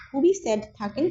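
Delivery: ordinary talk, nothing marked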